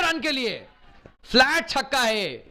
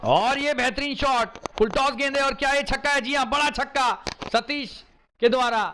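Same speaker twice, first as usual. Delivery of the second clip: higher, rising excitement over a six; excited cricket commentary; 7 semitones higher, 3 dB louder than usual. Real, not acted. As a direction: call the six as it flies. Commentator calls a big six.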